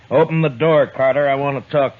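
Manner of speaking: loud, firm order through a door; commanding shout. Acted. Marshal ordering Carter to open up, loud command. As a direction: raise the voice to be heard through wood. Marshal demands entry loudly.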